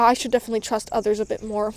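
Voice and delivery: normal talking voice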